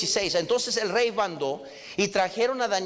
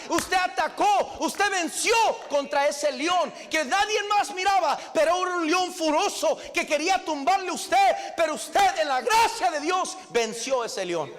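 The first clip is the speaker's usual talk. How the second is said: preaching at full force; 5 semitones higher than usual. Real, not acted. Preacher rallying the congregation against the lion, shouting. Sermon climb to the climax.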